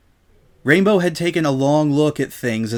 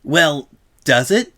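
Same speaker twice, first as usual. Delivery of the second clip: Rainbow Dash voice; 6 semitones higher, faster than usual. Acted. Rainbow feigning innocent argument.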